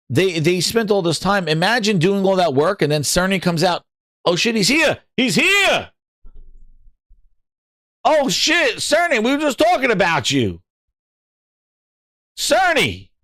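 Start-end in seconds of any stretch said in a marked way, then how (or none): 4.2-13.1 panicked excitement. Pretend. Mock panic at Cerny arriving.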